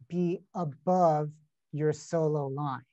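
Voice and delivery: ordinary talk, nothing marked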